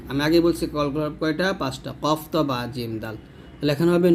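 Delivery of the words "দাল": ordinary talk, nothing marked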